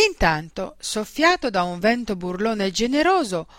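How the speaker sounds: ordinary delivery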